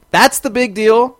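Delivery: mock the letdown; real indignation at the weak plot; exasperated. Real, not acted. Ranting about the episode.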